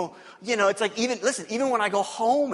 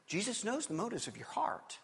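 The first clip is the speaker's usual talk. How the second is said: whispered; 3 semitones higher, 12 dB quieter than usual. Real, not acted. Pastor drops voice for emphasis.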